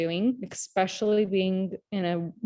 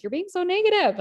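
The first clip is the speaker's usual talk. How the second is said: raised-pitch mock reproach; retold complaint; 11 semitones higher, 3 dB louder than usual. Friend tells speaker off, retold high-pitched complaint. Quotes Nicole's complaint to her.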